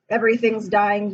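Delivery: ordinary talk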